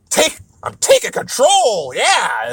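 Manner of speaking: high, far-off pirate growl taking the wheel; old pirate captain. Retold or imitated. Pirate grabbing the ship's wheel, mock commanding shout. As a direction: bark it like a pirate seizing the helm. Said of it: "like an old pirate".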